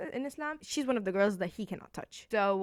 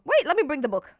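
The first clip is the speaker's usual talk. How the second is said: higher and louder, blurted out; sudden excitement; 7 semitones higher, 11 dB louder than usual. Real, not acted. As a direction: burst out as the idea hits. Suddenly remembers to fetch book.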